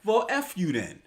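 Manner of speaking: a sulky rejected guy snapping back; mock angry retort.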